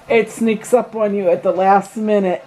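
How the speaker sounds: ordinary talk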